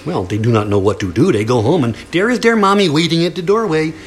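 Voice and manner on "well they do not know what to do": usual speaking voice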